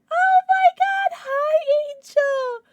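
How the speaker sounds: very high, drawn-out coo; adoring squeal. Real, not acted. Streamer greeting her new plushie, adoring high squeal.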